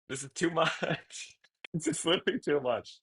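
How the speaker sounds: giddy and overwhelmed; overwhelmed, laughing. Real, not acted. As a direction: laugh helplessly as you protest. Overwhelmed by donations from chat.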